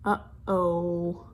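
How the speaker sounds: low, drawn-out "Uh-oh"; ominous uh-oh. Acted. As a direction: let the trouble hang in a low voice. Reader foreshadows trouble.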